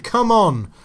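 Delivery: loud, high "come on" building up; urging shout. Real, not acted. Urging his striker through on goal, loud rising shouts. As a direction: roar the striker on.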